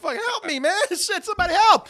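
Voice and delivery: high, panicked cry for help, half laughing; running from a pig, hammed-up panicked yelling; mock panic. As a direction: imitate a man fleeing in terror. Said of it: "He's like".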